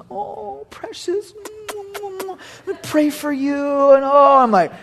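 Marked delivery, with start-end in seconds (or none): 0.1-3.5 soft, high-pitched mock mothering; cooing mama. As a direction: coo over a hurt child, imitating a tender mother. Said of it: "of course, Mama comes".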